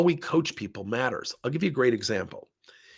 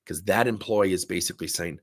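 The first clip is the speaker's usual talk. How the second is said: ordinary delivery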